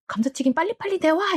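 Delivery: high, fast, impatient order; mock angry demand. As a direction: imitate a cross husband rushing his wife. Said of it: "이렇게 화를 내는 모습".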